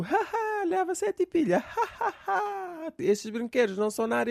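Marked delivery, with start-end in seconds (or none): 0.0-2.9 high, taunting laughter; mocking toy. Toy laughing at the parent needing seven batteries. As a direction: cackle at the buyer. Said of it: "já a gozar contigo".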